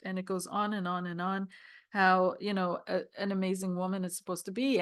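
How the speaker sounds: ordinary talk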